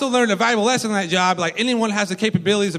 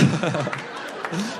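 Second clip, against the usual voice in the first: soft laugh; 3 semitones lower, 7 dB quieter than usual. Real, not acted. Cracking up mid-story.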